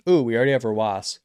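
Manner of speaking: talking through a yawn. Real, not acted. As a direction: yawn mid-sentence while speaking. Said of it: "Sorry for yawning".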